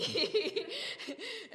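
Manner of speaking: high rising laugh. Real, not acted. Laughing while telling the story.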